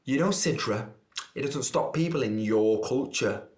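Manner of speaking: mock teacher, knowingly condescending. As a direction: imitate a teacher explaining with false tact. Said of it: "What did she say again".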